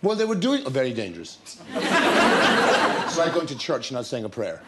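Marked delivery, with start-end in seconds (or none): 1.4-3.0 a high, airy burst of laughter; breathy laugh. Real, not acted. Actor laughing to the host about not drinking, airy high laugh. Laughing at his own joke.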